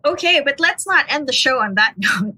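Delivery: laughing. Real, not acted. Lightening a grim story.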